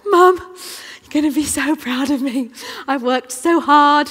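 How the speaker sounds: put-on high, loud excited child's voice. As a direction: burst in proud and eager. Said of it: "And she's like".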